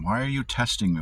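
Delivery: ordinary talk, nothing marked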